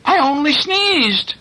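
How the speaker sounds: high, slow farmer voice; meek farmer. A narrator's reading. Farmer explains the chaos to his wife, high slow plea. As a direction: plead it high and drawn out. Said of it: "He says".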